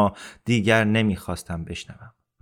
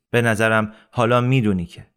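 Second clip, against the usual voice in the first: ordinary delivery, nothing marked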